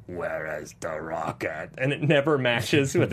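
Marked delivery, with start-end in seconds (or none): 0.0-1.7 much lower, slow hushed whisper. Retold or imitated. Enforcer's actual voice, whispered and soft. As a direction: breathe it out in a creepy whisper. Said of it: "But instead it's like".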